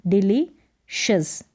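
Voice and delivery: "डिलीशियस" slowly split, dili, pause, shus; sounding out. Shown as a demonstration. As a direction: break "डिलीशियस" slowly before the final shus. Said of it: "इसको शस करके इसका उच्चारण". (डिली-शस, धीरे तोड़कर)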